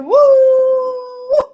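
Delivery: playful imitated howl; wolf howl. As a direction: throw back your head and howl like a wolf. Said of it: "They started making a sound".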